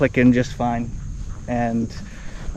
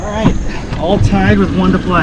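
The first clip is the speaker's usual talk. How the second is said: higher broadcaster recap; golf commentator; 4 semitones higher than usual. Pretend. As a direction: sum up the match like TV golf.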